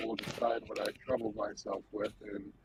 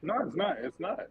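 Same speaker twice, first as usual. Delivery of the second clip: quick denial; 6 semitones higher than usual. Acted. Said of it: "this feels a little intimidation-y".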